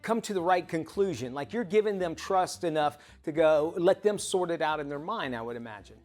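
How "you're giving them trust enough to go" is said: usual speaking voice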